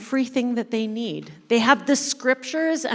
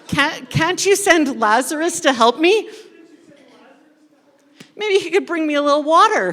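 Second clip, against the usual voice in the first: higher, louder desperate begging, a bit hammed-up; desperate pleading; 5 semitones higher, 5 dB louder than usual. Rich man begs Abraham for water, mock desperate plea. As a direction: whine for help as the rich man. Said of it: "The rich man looks desperate".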